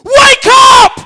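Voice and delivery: very high, very loud screech; demanding cat. Acted. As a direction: screech it like a cat waking its owner. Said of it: "waking me up at three o'clock".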